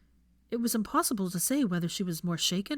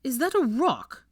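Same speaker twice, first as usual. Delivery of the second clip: incredulous; 7 semitones higher, 7 dB louder than usual. Acted. Incredulous shout.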